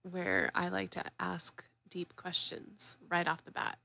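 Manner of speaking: soft unvoiced confession; whispering. Real, not acted. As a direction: whisper it like a secret. Confiding aside.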